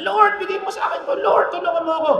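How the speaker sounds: high, pleading cry to the Lord. Retold or imitated. Loud praying voiced in sermon. (malakas na pagsusumamo)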